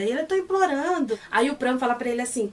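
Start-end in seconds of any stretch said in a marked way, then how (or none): 0.2-1.2 high, whiny put-on plea; mock pleading. Pet insists to Pran he is begging, whiny high plea. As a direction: whimper it like a playful victim.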